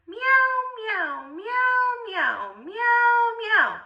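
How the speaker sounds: high sung-out meowing; cat meows. A narrator's reading. Narrator imitating the cats: high meows. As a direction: meow like a sneaky cat. Cat sound effects in story.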